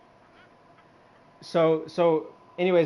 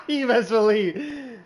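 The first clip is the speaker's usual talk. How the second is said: slow, laughing through it; giggling, half-crying with laughter; 10 semitones higher, 5 dB louder, slower than usual. Real, not acted. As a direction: crack up as you say it. Laughing at his own joke.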